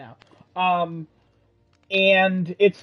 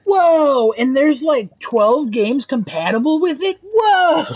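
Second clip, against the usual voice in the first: high, loud drawn-out whoas; mock amazement; 8 semitones higher, 4 dB louder, slower than usual. Cohost teasing about twelve compatible games, mock amazement. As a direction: play up exaggerated awe.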